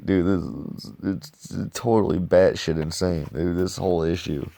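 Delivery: ordinary talk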